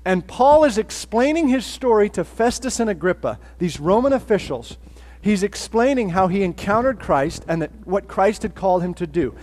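ordinary talk

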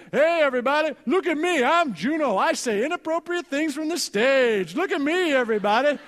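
high and loud, hammed-up boasting; Juno, goofy show-off. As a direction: shout it like a silly attention seeker. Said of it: "Here's my impression of Juno".

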